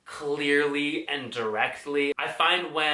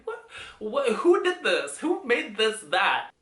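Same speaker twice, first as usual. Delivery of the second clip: high-pitched comic bafflement; hammed-up incredulity; mock disbelief; 7 semitones higher than usual. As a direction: demand an explanation in high, baffled outrage. Mock outrage at a Spanish word.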